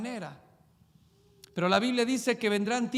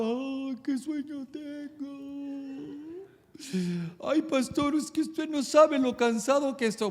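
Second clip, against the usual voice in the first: drowsy churchgoer; 3 semitones higher than usual. Retold or imitated. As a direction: yawn the words out like a dozing churchgoer. Sleepy churchgoer complaining to pastor.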